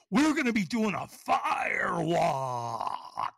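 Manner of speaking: high, slow, hammed-up holler; exaggerated announcement. Buddy reveals the most important part, hammed-up holler. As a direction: imitate a booming reveal, stretching the last words way out. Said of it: "That's kinda like what it sounded like".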